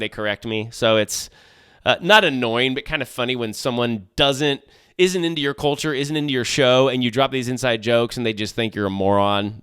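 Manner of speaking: ordinary talk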